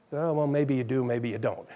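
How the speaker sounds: low, soft and doubtful; a quiet shrugging reply; doubtful shrug. Retold or imitated. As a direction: mutter it like you're unsure. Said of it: "if I tell you something one time".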